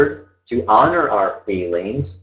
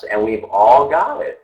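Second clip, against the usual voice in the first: ordinary talk, nothing marked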